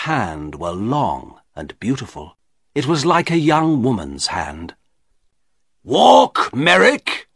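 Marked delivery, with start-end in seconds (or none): 5.8-7.3 loud, high, angry order; angry command. A narrator's reading. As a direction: order him about furiously. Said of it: "said the shopkeeper angrily".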